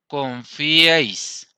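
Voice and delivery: "Confiéis" stretched out, syllable by syllable; teacher sounding out "Confiéis" very slowly; very slow syllable demo. Shown as a demonstration. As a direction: draw "Confiéis" out very slowly for the class. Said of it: "Estamos nombrando dos sílabas".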